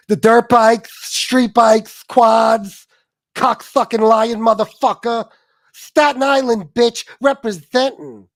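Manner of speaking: high, loud tough-guy punches; BK impression. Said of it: "the B K impression".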